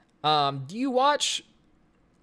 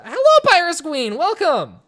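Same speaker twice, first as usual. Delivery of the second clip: excited greeting; 9 semitones higher, 10 dB louder than usual. Real, not acted. Greeting a viewer in chat.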